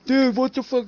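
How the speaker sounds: high-pitched outraged mock rival. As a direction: squeal it like an offended kid. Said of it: "he's like".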